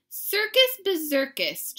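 loud, high, slow scoff; exasperated groan. A narrator's reading. Mama dismisses the girls' circus idea, groaning loudly. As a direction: stretch it out in exasperation. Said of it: "groaned Mama".

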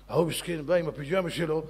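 usual speaking voice

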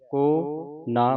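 ਕੋ ਨਾ with a long ਕੋ, then a break; separate words. Shown as a demonstration. Teacher models ਕੋ ਨਾ for students, words apart. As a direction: hold ਕੋ, pause, then say ਨਾ. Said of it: "ਵੱਖਰਾ ਵੱਖਰਾ".

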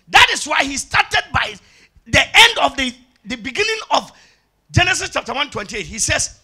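loud, emphatic pulpit delivery; forceful scripture preaching. Acted. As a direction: proclaim it loudly, phrase by phrase.

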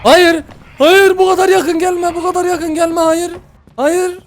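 high, frantic no no; panicked high pleading. Real, not acted. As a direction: beg her to stay away in panic. Teacher right behind him, panicking. (yüksek sesle panik içinde yalvarma)

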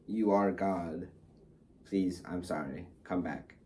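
soft, fading plea for her to come back; hushed mock begging. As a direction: beg under your breath, half-joking.

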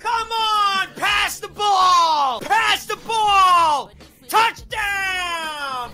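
slow, loud, high mock American yelling; American sports fan. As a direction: yell like a football fan in America. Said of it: "I can't do this bloody accent".

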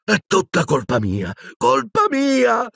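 high, slow, breaking wail of colpa mia; an old wizard sobs, blaming himself; sobbing. A narrator's reading. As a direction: sob it out with guilt. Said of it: "singhiozzò".

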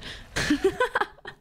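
high burst of laughter. Real, not acted. Streamer amused by a chat comment, laughing.

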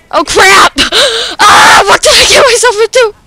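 high, loud, fast panic; panicked shout. Real, not acted. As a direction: yelp in laughing alarm. Suddenly in danger in game.

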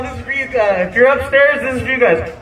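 ordinary talk, nothing marked

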